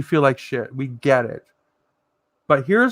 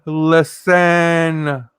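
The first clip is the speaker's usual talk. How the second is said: louder, stretched LISTEN; drawn-out attention call; 5 dB louder than usual. Real, not acted. Calling viewers to hear a story, long drawn-out word. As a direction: draw out LISTEN long and emphatic.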